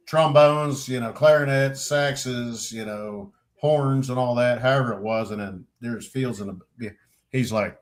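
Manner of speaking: ordinary talk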